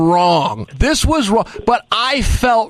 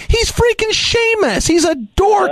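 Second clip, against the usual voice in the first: much higher, scoffing put-down; mocking exasperation; 13 semitones higher, slower than usual. Real, not acted. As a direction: pitch it up high in disbelieving scorn. Mocking the wrestler who snubbed him.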